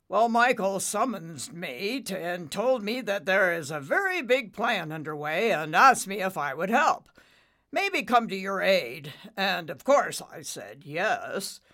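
loud, hearty dad voice; booming father cloud. Acted. Father explaining his late arrival, loud and hearty. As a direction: project like a big storm-cloud father.

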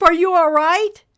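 loud, high, worried question; gasped alarm. A narrator's reading. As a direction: call out in shocked concern. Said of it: "Nimbus gasped".

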